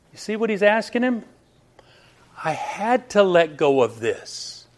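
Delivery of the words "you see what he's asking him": ordinary delivery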